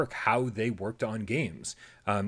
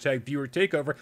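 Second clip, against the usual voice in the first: ordinary talk, nothing marked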